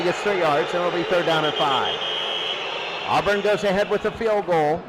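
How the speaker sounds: ordinary talk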